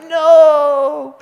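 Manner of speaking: long, loud, high "no"; hammed-up wail of instant regret; mock anguished cry. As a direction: cry out in exaggerated dismay, stretching the word. Acting regret after blurting something dumb.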